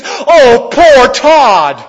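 loud, high ironic lament; mock pity. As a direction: cry it out as if he were pitiable, tongue in cheek. Mock pity for groom leaving family.

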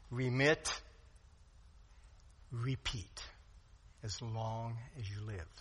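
ordinary delivery

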